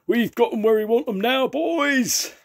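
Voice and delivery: very high, loud triumphant pirate roar. Acted. Jake gloating to his men mid-attack, loud high roar. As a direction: bellow the victory to the crew. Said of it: "roared Cutthroat Jake".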